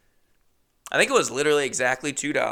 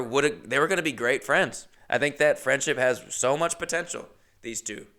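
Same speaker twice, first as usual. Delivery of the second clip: ordinary delivery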